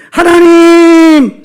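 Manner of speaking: long, high, loud cry to heaven; crying out to God. Acted. Believer crying out to God unanswered, loud drawn-out shout. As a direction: call out at full voice, stretching it out. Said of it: "소리쳐도".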